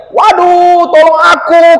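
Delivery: high, loud, terrified cry for help; a boy shrieking as snow falls on him; child screaming for help. Acted. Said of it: "kata si Luka". (jeritan anak minta tolong, tinggi dan keras)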